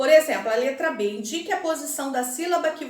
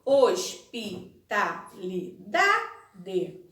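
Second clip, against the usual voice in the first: "Hospitalidade" very slow and lower, one syllable at a time, DA stressed; slow, paused syllables; 5 semitones lower, much slower than usual. Shown as a demonstration. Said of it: "Pronuncie pausadamente a palavra hospitalidade".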